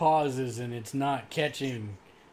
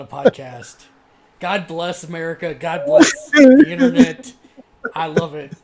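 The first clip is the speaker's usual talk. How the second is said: laughing loudly; 2 semitones higher, 15 dB louder than usual. Real, not acted. Cracking up at nerdy podcast talk.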